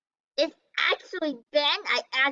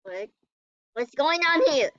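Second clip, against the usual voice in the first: normal talking voice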